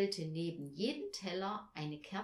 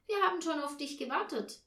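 put-on higher, louder, quick child's voice; child's impatient complaint; 8 semitones higher, 5 dB louder, faster than usual. A narrator's reading. Barbie to her mother after waiting, impatient complaint.

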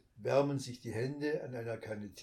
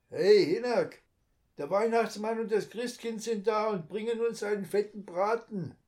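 loud, high teasing shout; joking call; 10 semitones higher, 9 dB louder than usual. A narrator's reading. Fisherman shouts jokingly to his mate below deck. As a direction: call it down into the cabin as a joke. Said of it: "ruft er in die Kajüte".

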